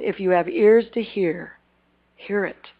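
usual speaking voice

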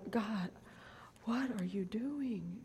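hushed whisper to God. Retold or imitated. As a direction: whisper it in disbelief. Bewildered aside to God.

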